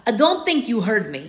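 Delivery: put-on menacing line in a thick Russian accent; guard threatening in a thick Russian accent; dangerous Russian accent. A narrator's reading. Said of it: "dangerously in his accent thick Russian".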